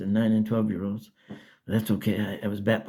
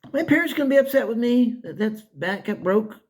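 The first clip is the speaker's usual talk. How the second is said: put-on worried kid; 12 semitones higher, 4 dB louder than usual. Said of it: "I wondered".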